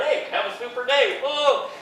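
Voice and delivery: cheery greeting. Pretend. Passing the greeting on.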